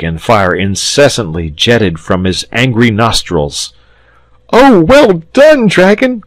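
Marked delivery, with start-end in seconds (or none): 4.5-6.2 much higher, louder thrilled cheer; excited cry. Acted. Boy cheering the dragon's entrance, excited high cry. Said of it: "cried the boy excitedly".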